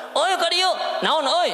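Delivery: high shouted call. Retold or imitated. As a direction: holler it across at someone. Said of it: "Kalau kita".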